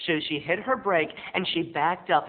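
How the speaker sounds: ordinary delivery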